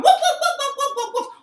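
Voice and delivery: very loud, high volley of woofs; excited dog at full tilt; frantic barking dog. Acted. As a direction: bark wildly in a chase.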